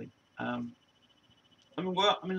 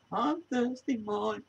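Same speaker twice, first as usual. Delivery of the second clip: quoted performer impression; 8 semitones higher, 3 dB quieter, slower than usual. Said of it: "looked off the scent like this and go".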